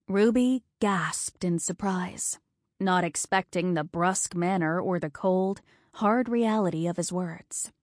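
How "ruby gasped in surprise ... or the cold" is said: usual speaking voice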